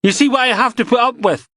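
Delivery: exasperated outburst. Acted. DI appealing to Finney.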